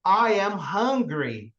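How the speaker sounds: slow, careful I am hungry; teacher modelling I am hungry slowly; slow English example. Shown as a demonstration. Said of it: "por exemplo".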